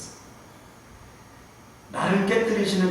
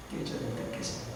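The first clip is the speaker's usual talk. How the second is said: the repeat 깨져야 될 때 깨져요 whispered, much softer; 11 dB quieter than usual. Shown as a demonstration. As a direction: drop to a hushed whisper for emphasis. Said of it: "repeat dropped to whisper". (속삭이듯 조용히)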